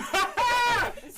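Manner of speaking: loud, very high burst of laughter; big high laugh. Real, not acted. Host cracking up at a guest's cartoon joke, big high laugh.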